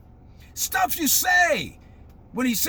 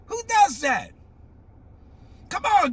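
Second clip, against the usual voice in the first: normal talking voice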